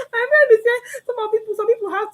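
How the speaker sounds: words pushed out high through laughter; laughing through words. Real, not acted. Retelling her friend's bestie joke, laughing high. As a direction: keep laughing while you say it.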